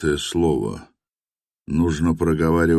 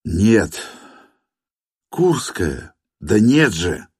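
almost yelling; 3 semitones higher, slower than usual. A narrator's reading. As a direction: nearly yell it. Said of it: "Он почти орал". (почти крича)

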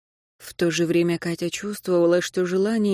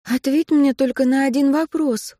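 pleading; 7 semitones higher, 4 dB louder than usual. A narrator's reading. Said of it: "взмолилась Катя".

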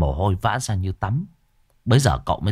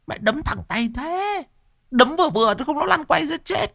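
squeaky aside. Acted. Grumbling at the hard punch.

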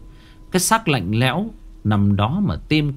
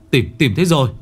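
loud, higher excited shout; 6 semitones higher, 5 dB louder, faster than usual. A narrator's reading. Villager shouting to everyone that the body is found. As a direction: call out the find across the river. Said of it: "Một người hét lên".

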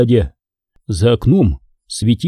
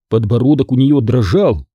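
plain reading voice

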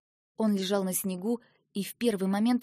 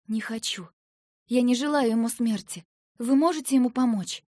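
горячая просьба; 4 semitones higher, 7 dB louder than usual. A narrator's reading. Героиня горячо просит старика.

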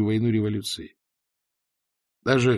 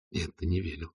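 fearful; 8 dB quieter than usual. A narrator's reading. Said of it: "ответил он себе со страхом".

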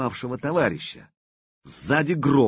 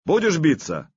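insistent demand; 7 semitones higher, 3 dB louder than usual. Acted. Artyom presses his challenge.

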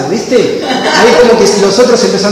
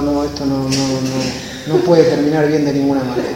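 low, softer murmured warning; hushed worried aside; 7 semitones lower, 7 dB quieter than usual. Retold or imitated. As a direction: say it under your breath, worried.

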